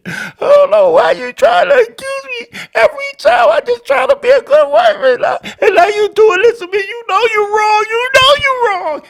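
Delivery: high, loud hammed-up wailing; mock crying. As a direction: weep accusingly like a wronged wife. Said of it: "when they're crying and weeping".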